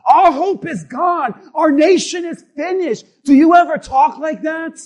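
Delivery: loud, punched sermon cries; forceful preaching. Acted. Preacher challenges the church on hopeless talk, forceful preaching. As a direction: drive each short phrase at the congregation.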